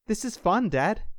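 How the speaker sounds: put-on high, playful little-boy voice; five-year-old boy. A narrator's reading. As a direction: pipe up as a small son. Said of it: "The five-year-old giggled".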